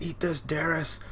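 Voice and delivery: hammed-up anime attack line; acted attack cry. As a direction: taunt the enemy as you strike, pitched high. Acting out character launching an attack.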